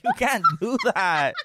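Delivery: laughing, lisping boxer voice; a hint of a lisping boxer; Mike Tyson impression. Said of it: "Mike Tyson".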